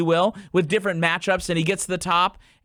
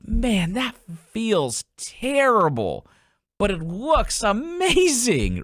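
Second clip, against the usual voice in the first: higher, slow, wondering student voice; puzzled student; 5 semitones higher, slower than usual. Retold or imitated. Golf coach imitating a student, higher and slow. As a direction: mimic a baffled golfer, drawn out.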